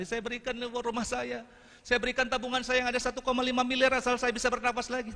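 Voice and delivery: mock high, pleading offer; hammed-up begging to breathe again; desperate plea. Speaker voices a breathless person offering everything to breathe. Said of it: "Ibu pasti merelakan rumah".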